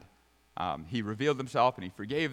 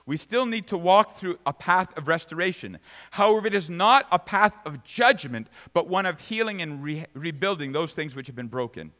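loud, raised, emphatic sermon voice; forceful preaching; 5 semitones higher, 9 dB louder than usual. Real, not acted. Preacher telling church of the path to restoration, forceful preaching. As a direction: drive the point home to the congregation.

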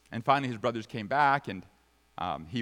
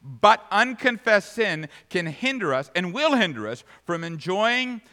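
loud, emphatic voice climbing higher; forceful preaching; 5 semitones higher, 8 dB louder than usual. Real, not acted. Preacher warning church of unconfessed sin, forceful preaching. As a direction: build in force and pitch to a warning.

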